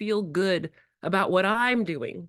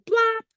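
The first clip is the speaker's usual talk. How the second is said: silly blap; 5 dB louder than usual. Acted. Playful sound with a child.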